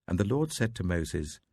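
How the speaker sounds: plain reading voice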